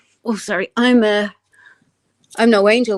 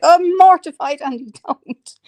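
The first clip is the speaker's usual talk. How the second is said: high-pitched embarrassed protest; mortified plea; 8 semitones higher, 4 dB louder than usual. Retold or imitated. Retelling her protest to Andy, high mortified plea. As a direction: plead in mock embarrassment, voice raised high.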